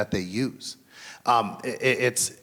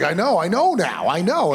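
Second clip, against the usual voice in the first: much higher, louder, dragged-out eye-roll; exasperated kid; 9 semitones higher, 7 dB louder than usual. Retold or imitated. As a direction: imitate the daughter brushing him off, pitched way up. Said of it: "And she's just like".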